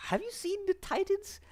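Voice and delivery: high-pitched disbelieving challenge; incredulous. Real, not acted. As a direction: question it in squeaky, high disbelief. Incredulous at an implausible escape.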